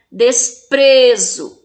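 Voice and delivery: "Desprezo" very slowly, PRE loud and long; loud, drawn-out PRE in "Desprezo"; tonic syllable stressed. Shown as a demonstration. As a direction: punch and hold PRE in "Desprezo". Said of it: "fala com mais intensidade".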